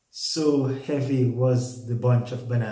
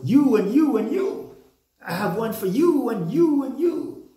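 high, loud excited TV-host call; celebrity giveaway; 10 semitones higher, 4 dB louder than usual. Retold or imitated. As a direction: mimic a star handing out prizes to the crowd. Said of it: "famous modern day personality talks about".

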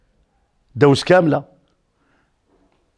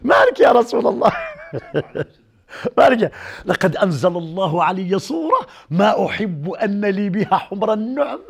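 imitated laughing; 3 semitones higher than usual. Preacher acting out the Prophet laughing with companions, imitated laughing. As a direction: retell it through delighted laughter. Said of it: "ناظر هو يضحك، يضحك".

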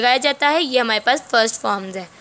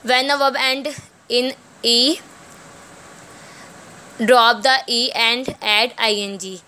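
slow rule reading; much slower than usual. Acted. Reads English rule slowly aloud.

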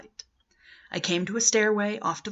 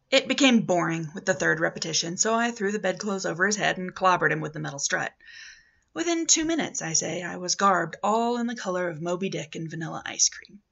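ordinary delivery

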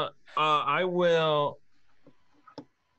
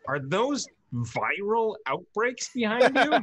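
higher, drawn-out mock question; imitated puzzled players; 7 semitones higher than usual. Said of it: "the people were like".

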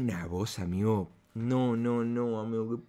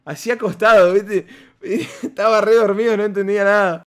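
laughing while telling; 8 semitones higher, 10 dB louder than usual. Real, not acted. Laughing at sleepy friend.

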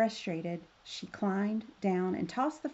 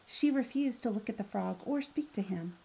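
plain reading voice

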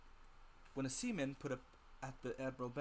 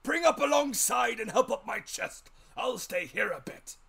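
put-on old sea captain; 10 semitones higher, 14 dB louder than usual. A narrator's reading. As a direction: growl it out like a grizzled old seaman. Captain gives orders.

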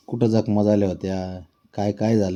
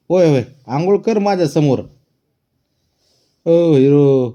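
loud, higher call to the cat; 6 semitones higher, 8 dB louder than usual. Real, not acted. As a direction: call the cat over, playfully. Calling the cat loudly.

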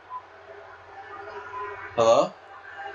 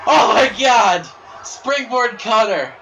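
loud, higher and slow, stretched out; excited shout; 7 semitones higher, 6 dB louder than usual. Real, not acted. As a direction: belt out the big move. Commentating a springboard cutter.

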